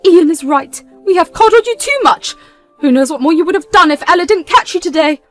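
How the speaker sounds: a mother finally condemning her spoiled daughter; angry rebuke. Acted. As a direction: come down hard on her.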